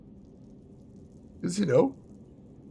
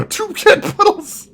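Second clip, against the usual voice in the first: delighted; 12 semitones higher, 5 dB louder, slower than usual. Real, not acted. Gleefully reading out a silly name.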